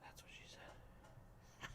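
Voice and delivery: slow, breathy whisper; whispered joke. Real, not acted. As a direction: sneak the punchline in a whisper.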